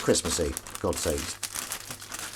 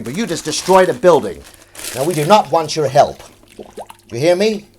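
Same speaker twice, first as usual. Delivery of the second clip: higher, louder, hammed-up dialogue; radio scene voices; 4 semitones higher, 9 dB louder than usual. Reenacting the radio scene for chat, hammed-up. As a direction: act out the shouting police chief.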